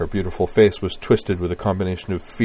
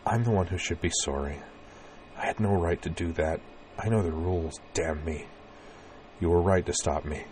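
gentle reassurance; 9 dB quieter than usual. Acted. Gentle comforting of frightened woman.

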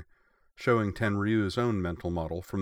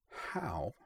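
a near-silent, much softer gasped question; stunned inner question; 11 dB quieter than usual. A narrator's reading. Kongo sees her submarines vanish, stunned and hushed. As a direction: barely breathe it in disbelief.

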